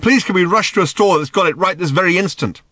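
high, loud mock excitement; sarcastic shouting. A narrator's reading. Arthur pretending excitement over Ford's guide, sarcastic shouting. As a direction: gush furiously with scathing sarcasm. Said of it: "shouted Arthur again".

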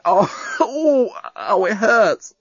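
slow laughing groan; laughing in pain. A narrator's reading. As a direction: laugh though it hurts.

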